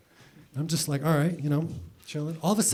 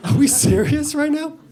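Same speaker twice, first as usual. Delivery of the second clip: much higher, louder disbelief; are you kidding me, outraged; shrieking disbelief at the doctor; incredulous; 11 semitones higher, 5 dB louder than usual. Retold or imitated.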